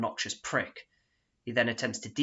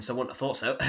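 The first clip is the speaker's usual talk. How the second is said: ordinary talk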